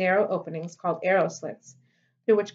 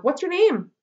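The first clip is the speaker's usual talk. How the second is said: high call after someone walking away; yelling after him; 9 semitones higher, 4 dB louder than usual. A narrator's reading. Girl calling after departing Ware, a raised call. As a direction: call out across the yard. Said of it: "she yelled".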